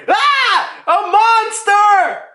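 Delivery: very high mock scream, drawn out; hammed-up terrified shriek at a monster; freaking out. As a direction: imitate panicked screaming, loud and high. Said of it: "freaking out".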